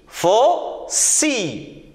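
Faucille very slowly, with a gap: fau, cille; split into syllables. Shown as a demonstration. Teacher asking pupils to count syllables, Faucille split after "fau". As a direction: break Faucille in two for counting. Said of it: "Combien de syllabes?".

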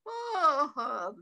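high acted laugh subsiding into a sigh; laugh, then wind down with a long oh; loud storybook laugh trailing into a tired groan; laughing bear. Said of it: "growled Bear".

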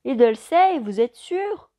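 loud doubtful shout. A narrator's reading. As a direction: shout the question over noise. Pilot shouts over the engine.

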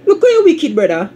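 high-pitched teasing cry of disbelief; mock-shocked exclamation. Real, not acted. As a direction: squeal it high and loud, playfully scandalised.

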